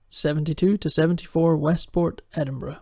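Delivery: usual speaking voice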